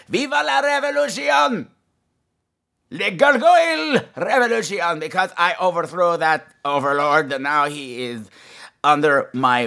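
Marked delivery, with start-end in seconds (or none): 0.1-5.0 much higher, ringing battle cry; triumphant rallying cry. Acted. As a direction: proclaim the revolution in glee.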